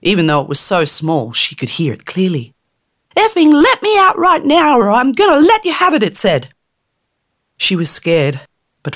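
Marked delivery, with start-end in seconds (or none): 3.2-6.0 high, loud, cursing tiny fairy; rude angry fairy. Acted. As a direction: snarl threats through the jar in a tiny high voice. Trapped fairy threatening Tammy.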